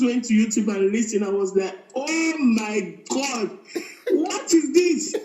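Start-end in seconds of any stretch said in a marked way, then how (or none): 2.0-5.2 drawn-out, laughing retold amazement, rising at the end; mock screamed shock. Wife retelling her first YouTube listen, mock screamed shock. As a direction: replay your shock slowly, high on the question. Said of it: "I was screaming".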